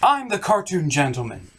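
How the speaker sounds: ordinary talk, nothing marked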